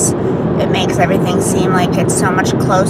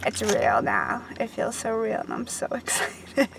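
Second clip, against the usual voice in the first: much softer, dropping to a laughing whisper; overwhelmed hush; 12 dB quieter than usual. Real, not acted. Mom-to-be telling viewers birth is near, in an awed near-whisper. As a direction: let it sink in, barely voiced. Overwhelmed before baby's arrival.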